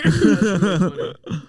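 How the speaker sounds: loud, slow, laughing reaction; real laughter bursting through the words; laughing hard. Real, not acted. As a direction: crack up as you say it. Cracking up at another's joke.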